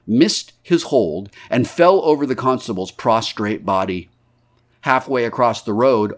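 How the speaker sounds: plain reading voice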